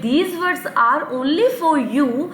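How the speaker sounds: very slow, deliberate English line; slow emphatic English. Shown as a demonstration. Teacher tells the kids slowly, in English. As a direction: lay each word down slowly. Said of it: "teacher stresses the English sentence slowly".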